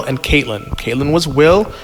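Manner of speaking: ordinary talk, nothing marked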